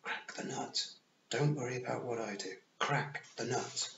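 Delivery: urgent whisper to her brother. A narrator's reading. Tiffany whispers urgently to Roland, hushed and pressing. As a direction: hush it so the Queen can't hear. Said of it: "whispered to Roland".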